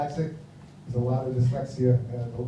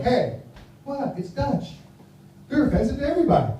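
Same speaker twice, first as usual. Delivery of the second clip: mock Italian; 8 semitones higher than usual. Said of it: "If this Italian, like".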